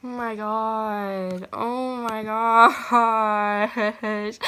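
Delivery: tearful awe. Real, not acted. Overwhelmed by acceptance, tearful.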